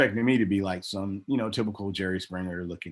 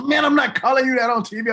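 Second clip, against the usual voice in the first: much higher, louder disbelieving protest; incredulous refusal; 7 dB louder than usual. Retold or imitated. Partner refusing to say the insult on TV, high and incredulous. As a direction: balk at the idea, loud and high.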